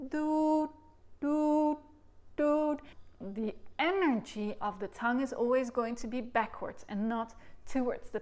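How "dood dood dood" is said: "dood" sung high with a clipped stop at the end; high chanted "dood" that shuts off the air; dood syllables. Shown as a demonstration. Teacher demonstrating to students the stopping "dood", chanted high. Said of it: "So a dood does stop it".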